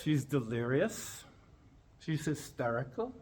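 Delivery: ordinary talk, nothing marked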